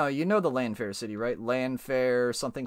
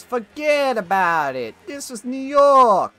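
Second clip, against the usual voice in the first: big, loud, drawn-out New York accent; New Yorker accent; 7 semitones higher, 6 dB louder, slower than usual. Shown as a demonstration. Showing off a favourite accent, hammed-up New Yorker accent. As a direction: ham up a high, slow New Yorker. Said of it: "I like, uh, New Yorker accents".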